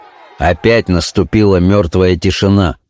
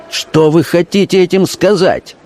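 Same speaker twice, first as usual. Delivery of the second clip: offended challenge; 9 semitones higher than usual. A narrator's reading. Accused dealer challenges the officer.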